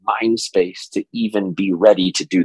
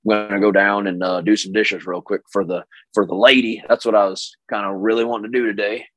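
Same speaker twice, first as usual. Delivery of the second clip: Oklahoma voice; 2 semitones lower than usual. Retold or imitated. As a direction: poke fun at an Oklahoma husband. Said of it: "this is my Oklahoma voice".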